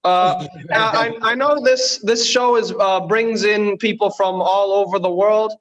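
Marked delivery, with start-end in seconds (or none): none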